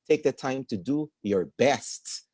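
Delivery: slow build to a stressed "best". Shown as a demonstration. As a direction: save the weight for "best". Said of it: "kung saan nyo po ilalagay yung stress".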